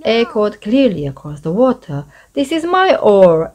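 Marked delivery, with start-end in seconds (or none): 2.3-3.5 high, loud goblin yell; loud squabbling yell. A narrator's reading. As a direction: yell to claim the oar. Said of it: "yelled the spotted goblin".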